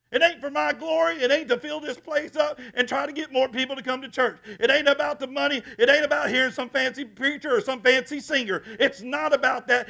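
loud, high, slowing to a shout; preaching at full force. Acted. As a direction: drive it home to the back pews. Preaching climax.

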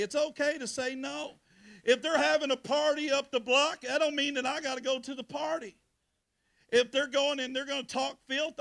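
loud, high preaching at full force. Acted. Pastor urging the congregation to say no, preaching hard. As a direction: preach it out to the whole church, building.

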